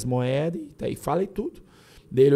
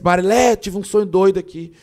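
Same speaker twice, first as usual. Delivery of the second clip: loud, higher retold call; excited calling out; 5 semitones higher, 7 dB louder than usual. Husband waking Marilé about a crazy dream, excited retold call. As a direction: blurt the news to wake her.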